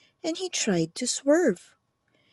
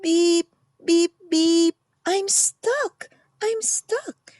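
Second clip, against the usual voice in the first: high, loud panicky beeping; frightened beeps; 6 semitones higher, 5 dB louder, slower than usual. A narrator's reading. Stuck little truck calling for help, frightened high beeps. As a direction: call for help in a rising panic. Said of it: "cried the Little Blue Truck".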